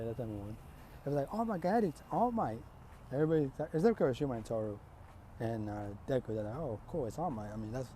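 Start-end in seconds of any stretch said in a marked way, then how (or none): 1.3-2.6 high-pitched fan gasp; excited. Acted. Classmates see their hero walk in, excited. 6.6-7.9 low, soft, underwhelmed reaction; unimpressed. Acted. Three students see the hero arrive, unimpressed.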